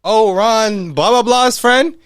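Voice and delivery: high, loud girly mock voice; mocking Mila. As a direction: ham up her chirpy confusion. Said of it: "Mila's like".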